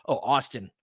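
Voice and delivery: ordinary talk, nothing marked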